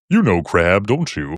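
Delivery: a lightly hammed-up street chess player, teasing; mildly exaggerated, sing-song teasing question. Chess player in the square quizzing passers-by, lightly exaggerated voice. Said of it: "speak with this exaggerated voice".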